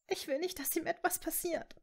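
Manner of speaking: high, soft, tearful voice; understated sob, pitched right up; sobbing. A narrator's reading. Hermine fears for Harry's life, softly sobbing. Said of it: "schluchzte sie".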